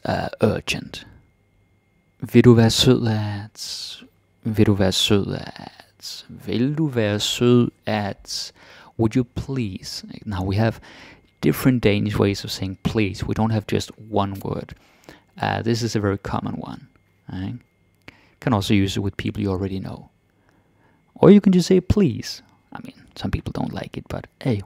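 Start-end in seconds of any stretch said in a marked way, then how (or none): 2.3-8.5 slow. Shown as a demonstration. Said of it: "slow teaching repeats". 21.2-22.4 excited. Real, not acted. Excited aside.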